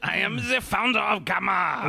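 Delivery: put-on high, slow French accent. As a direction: puff up in a thick French accent. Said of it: "in his French accent".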